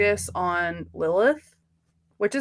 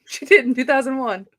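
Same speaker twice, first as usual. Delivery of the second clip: louder, amused repeat of the joke; laughing tease; 3 semitones higher, 6 dB louder than usual. Real, not acted. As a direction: keep the joke going with a laugh.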